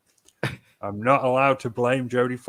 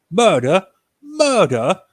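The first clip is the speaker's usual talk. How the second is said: high, loud incredulous Murder?; mock disbelief; 8 semitones higher, 8 dB louder, slower than usual. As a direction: echo the word back in hammed-up shock, pitched higher each time. Incredulous at the suggested topic.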